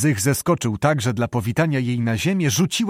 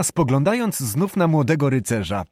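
plain reading voice

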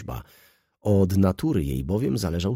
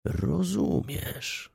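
much higher, very slow knowing rozumiesz; knowing drawl; 11 semitones higher, much slower than usual. Acted. Lawyer tells Wokulski he does understand, slow and knowing. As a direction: stretch it out, you see right through him.

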